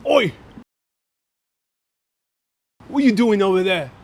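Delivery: lightly hammed-up cockney gangster call; Vinnie Jones impression. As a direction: bark it like a cockney hard man. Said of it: "Vinnie Jones is always like".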